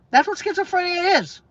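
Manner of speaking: ordinary talk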